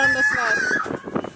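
high, slow excited shout. Real, not acted. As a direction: call out, thrilled, as she goes down again. Excited watching friend slide.

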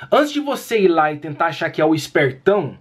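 ordinary delivery

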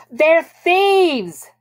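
high, loud, exasperated outburst; loud and impatient. A narrator's reading. Bobby answers Tom's worried question, loud and impatient. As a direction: shout it as if it's obvious. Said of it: "Bobby answered loudly, impatiently, as if the answer was obvious".

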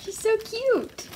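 high coaxing pet voice. Real, not acted. Owner coaxing her rat out of hiding, high baby talk. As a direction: coax her out, sweet and high.

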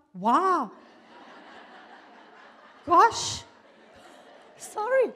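high, loud startled apology breaking into laughter; taken aback. Real, not acted. Speaker learns her time is up, taken aback and laughing. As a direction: caught out and amused, voice jumping up.

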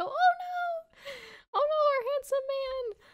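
very high falsetto fangirl squeal; mock high-pitched swooning girls. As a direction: squeal like a crowd of lovestruck girls. Girls swooning over Seknos.

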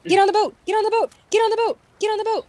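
urgent yelling. Real, not acted. Player yelling at teammate to board the boat, panicked shout.

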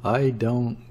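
ordinary delivery, nothing marked

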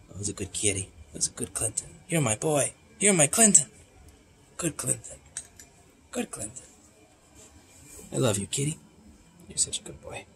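murmuring sweet nothings to the kitty; whispered cat cooing. Real, not acted. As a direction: coo tenderly under your breath to the cat. Cooing lovingly to his cat.

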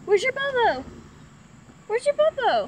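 put-on high cooing baby talk.

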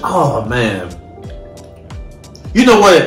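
ordinary talk, nothing marked

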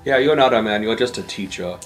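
ordinary talk

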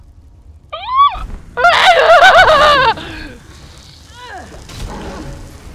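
high shrieking panicked laugh; scream-laugh in alarm; real panicked shriek of laughter. Real, not acted. Undead grandparents attack.

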